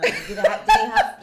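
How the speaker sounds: high squeaky laugh through words; words breaking into giggles. Real, not acted. Laughing mid-question.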